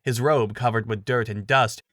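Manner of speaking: plain reading voice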